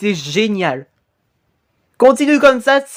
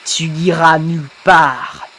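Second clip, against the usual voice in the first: low, slow phantom threat; menacing ghost; 3 semitones lower, slower than usual. Acted. Ghost refusing to let the victim leave, low slow menace. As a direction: stretch it out ominously, pitched down.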